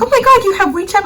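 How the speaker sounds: high, fast excited imitation; excited lady. Daughter voicing the designer's delight, high and fast. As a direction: rattle it off, delighted.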